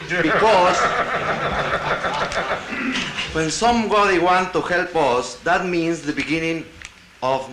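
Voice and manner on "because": laughing. Real, not acted. Joke about foreign help.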